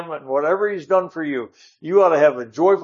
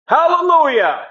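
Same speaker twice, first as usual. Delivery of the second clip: a sudden, much higher, louder shout of joy; hallelujah shout; 14 semitones higher, 7 dB louder than usual. Retold or imitated. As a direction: leap up and cry it out in a meeting. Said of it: "I scare 'em to death when I do that".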